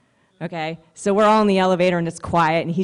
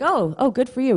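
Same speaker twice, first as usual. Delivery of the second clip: mock-impressed; 7 semitones higher than usual.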